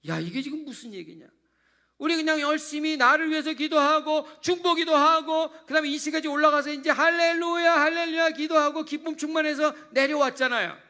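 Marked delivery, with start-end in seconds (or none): 2.0-10.8 louder and higher, full-force preaching; a fervent climax. Acted. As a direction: preach it with rising fervor. Preacher building to a peak.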